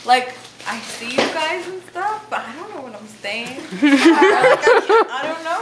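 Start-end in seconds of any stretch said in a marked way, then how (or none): 3.6-5.6 laughing. Real, not acted. Stoned, giggling mid-sentence.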